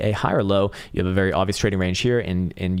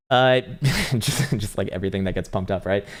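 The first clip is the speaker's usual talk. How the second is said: ordinary delivery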